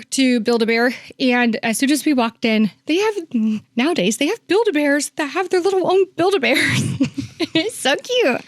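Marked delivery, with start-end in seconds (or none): 2.9-8.4 high, delighted gush over something cute; gushing excitement. Real, not acted. Telling the host about the bears, delighted and gushing. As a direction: squeal about how cute it is.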